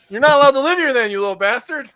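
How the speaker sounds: high, loud fake fury; hammed-up outraged dad; mock angry rant. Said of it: "I'm not being serious".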